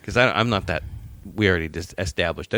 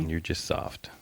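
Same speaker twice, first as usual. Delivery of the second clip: soft whispered jab, much quieter; 3 semitones lower, 10 dB quieter, much slower than usual. Real, not acted. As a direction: mutter the tease under your breath. Teasing reply in the argument.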